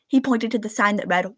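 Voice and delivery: plain reading voice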